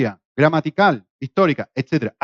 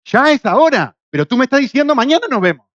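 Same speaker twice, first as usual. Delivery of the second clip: incredulous emphasis; 7 semitones higher, 5 dB louder than usual. Real, not acted. Preacher exclaims the contradiction.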